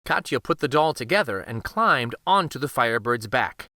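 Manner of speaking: ordinary delivery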